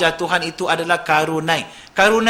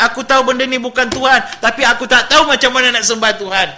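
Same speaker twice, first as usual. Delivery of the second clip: pitched-up, louder pleading as if through tears; retold tearful plea; 6 semitones higher, 5 dB louder than usual. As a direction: imitate a distraught woman begging to know how to worship. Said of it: "Dia menangis".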